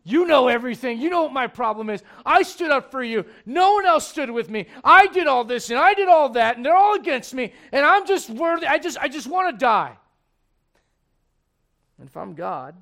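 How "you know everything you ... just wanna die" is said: loud, high, wounded outburst; Elijah's loud complaint. Retold or imitated. Prophet under the tree pouring out his bitter complaint to God, loud and high. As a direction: rant like a man who wants to die.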